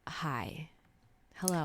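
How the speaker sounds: low, hushed British greeting; British accent. Acted. Co-host as charmer rolling up, soft British accent. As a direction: murmur it softly in a British accent. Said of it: "with a British accent".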